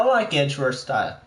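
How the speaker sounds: ordinary delivery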